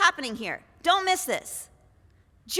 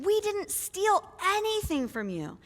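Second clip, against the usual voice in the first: stunned brothers; 6 semitones higher than usual. Acted. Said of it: "they're thinking".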